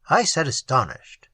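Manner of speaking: plain reading voice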